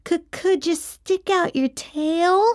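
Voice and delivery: timid half-whispered request; nervous whisper. A narrator's reading. Nervous kid asking to see the monster's tail, mildly hushed. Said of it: "I whispered".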